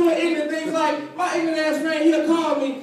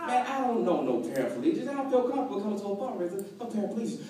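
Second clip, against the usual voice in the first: low, soft mumbled excuse; reluctant reply; 8 semitones lower, 8 dB quieter, faster than usual. Acted. Comedian declines friend's fundraiser invitation, reluctant. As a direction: back out quietly, pitched well down.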